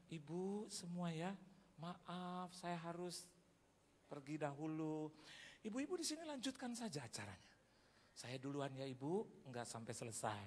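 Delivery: slow, soft polite excuse; polite farewell. Acted. Preacher excusing himself to the ladies before leaving, slow polite farewell. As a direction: excuse yourself gently, drawing out the words.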